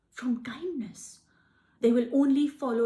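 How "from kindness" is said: soft unvoiced whisper, slowed down. Real, not acted. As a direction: drop to a breathy whisper on the last words. Trailing, emphatic ending.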